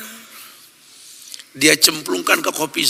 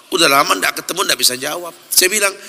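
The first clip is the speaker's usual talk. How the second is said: normal talking voice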